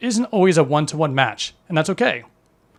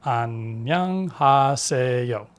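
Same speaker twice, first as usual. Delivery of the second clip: measured and carefully enunciated, lower in tone; clear, comfortable pace; 3 semitones lower than usual. Shown as a demonstration. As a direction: draw out each syllable for a learner. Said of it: "comfortable pace where you can clearly pronounce each syllable".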